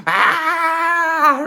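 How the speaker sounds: very high loud mock shriek; one frantic high cry; mum screaming. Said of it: "Straight from that pure".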